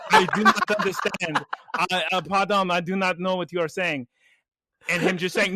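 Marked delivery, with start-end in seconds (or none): 0.1-4.1 put-on halting, careful French-accented English; French woman's accent. French woman not understanding the man, puzzled French accent. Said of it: "French woman who doesn't understand".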